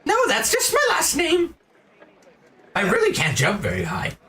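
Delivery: a very high, bouncy insect merchant; grasshopper shopkeeper. Acted.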